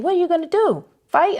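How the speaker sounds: high, incredulous mock taunt; retold amused disbelief; teasing challenge. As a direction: tease with a high-pitched question. Retold teasing while both laugh.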